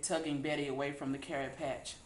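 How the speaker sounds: ordinary delivery, nothing marked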